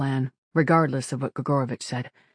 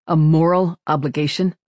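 louder echo question, rising at the end; skeptical surprise; 2 semitones higher, 6 dB louder than usual. A narrator's reading. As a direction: repeat it back as a raised-eyebrow question. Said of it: "eyebrows climbed toward his hairline".

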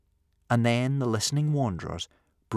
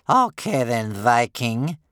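loud, higher grandmother voice; grudging old grandmother; 4 semitones higher, 9 dB louder, slower than usual. Acted. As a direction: agree loudly, still challenging him.

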